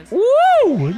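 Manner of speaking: high-pitched "woo" of amazement; long, high commentator whoop; excited whoop. Real, not acted. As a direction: whoop at a close call. Reacting to replay of near hit.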